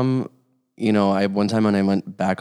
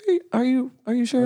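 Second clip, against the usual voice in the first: very high, hesitant questioning; tentative mock-mom voice, pitched way up; doubtful mom; faster than usual. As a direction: imitate a mother in squeaky disbelief. Retold mom doubting the coming out.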